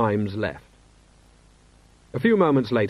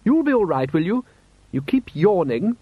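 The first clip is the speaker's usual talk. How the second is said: Lady Sybil voice; 8 semitones higher, 4 dB louder than usual. A narrator's reading.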